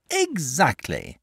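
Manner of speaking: slow, eager dragon exclamation; dragon cries out. Acted. Dragon eagerly agrees with the boy, slow cry. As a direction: pounce on the word, drawn out. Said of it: "cried the dragon".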